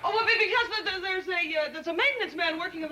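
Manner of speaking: high-pitched, stammering cover story; flustered excuse. Acted. Secretary hiding an ape from the chief, flustered and stammering.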